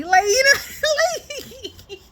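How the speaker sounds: high, squealing laughter; losing it mid-sentence; cracking up over Daniel's crush; laughing. Real, not acted.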